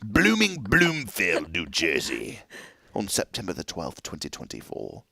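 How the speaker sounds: hammed-up old-sounding British accent; mock British accent. Host reads an auction lot to co-host, in mock British accent. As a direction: put on a vague, quiet British accent. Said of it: "I don't even know what accent this is".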